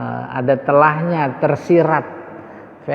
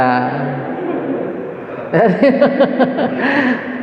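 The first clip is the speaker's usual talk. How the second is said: laughter; 7 semitones higher than usual. Real, not acted. Laughing at the class.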